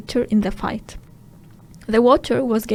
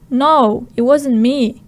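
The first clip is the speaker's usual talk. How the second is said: loud protest; 6 dB louder, slower than usual. Acted. Smee protesting to Hook.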